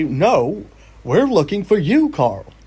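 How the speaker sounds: exasperated police. Retold or imitated. Police tell Carl he is the missing man, exasperated. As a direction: spell it out in disbelief. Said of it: "And they say".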